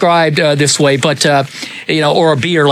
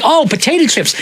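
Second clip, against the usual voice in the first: surprised; 10 semitones higher than usual. Retold or imitated. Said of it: "I'm like".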